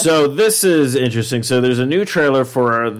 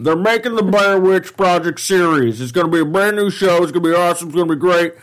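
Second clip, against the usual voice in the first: high, excited football commentator; John Madden impression; 6 semitones higher than usual. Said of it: "John Madden's more up here".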